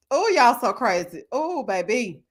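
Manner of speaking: ordinary talk, nothing marked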